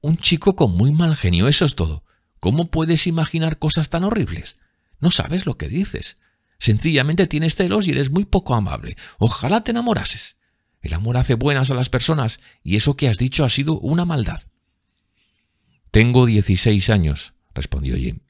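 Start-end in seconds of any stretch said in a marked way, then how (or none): 0.0-14.4 put-on high girlish reproach; scolding sister. Voices Sibyl scolding Jim. 15.9-17.3 indignant retort. A narrator's reading. Jim retorts to his sister.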